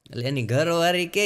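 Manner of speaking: ordinary talk, nothing marked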